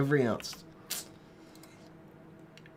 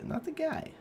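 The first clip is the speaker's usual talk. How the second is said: squeaky aside. Real, not acted. Squeaky correction.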